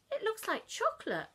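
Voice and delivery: brisk morning question; Mum asking. Acted.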